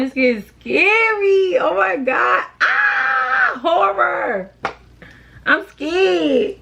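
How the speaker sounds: high, squealing mock fright; mock horror. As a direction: play up the fear, shrieking.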